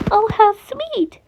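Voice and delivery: sweetly delighted chirp; sweet high gush. A narrator's reading. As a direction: gush it like a doting hen. Chica gushing over pizza.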